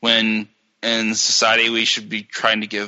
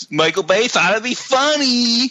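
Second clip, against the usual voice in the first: high, drawn-out mock delight; mocking sarcasm; 8 semitones higher than usual. Reviewer ridiculing a director's accent joke, mocking sarcasm. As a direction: push the pitch way up in sarcastic glee.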